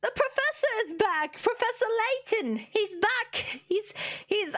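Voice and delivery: high, thrilled exclamation; excited. Real, not acted. Streamer cheering the professor's return, excited. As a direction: announce a favourite's return with delight.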